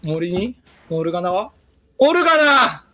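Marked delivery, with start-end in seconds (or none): 2.0-2.8 higher, louder call; playful shout. Pretend. Player calling an enemy's name for laughs, playful shout. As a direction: sing out the name in a mock shout.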